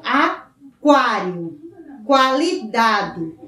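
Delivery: "aquário" very slow, a-KWA-rio with the u sounded; slow pronunciation demo. Shown as a demonstration. Teacher drills the sounded u for pupils, very slowly. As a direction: draw out "qualidade" with a clear kw. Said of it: "o U é pronunciado".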